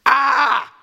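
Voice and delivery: loud high battle cry; excited speaker roaring after the quote; macho roar. Real, not acted. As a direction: let out a triumphant yell. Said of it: "How macho was that?".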